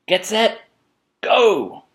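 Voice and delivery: high starting call rising to a loud go; kookaburra race starter. Acted. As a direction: hold them, then launch the race. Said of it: "said the kookaburra".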